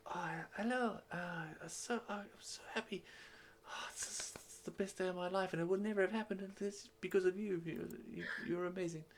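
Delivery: high, soft, gushing voice; super excited character. Acted. Dimble thanking the player on his best day, super excited. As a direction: gush breathlessly in a small high voice. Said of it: "he's super, super excited".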